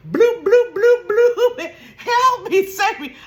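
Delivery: high loud bubbling bloops then cries; hammed-up drowning noises, high and loud; mock drowning bloops. Pretending to go under, high loud bloop sounds. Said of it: "I'ma holler".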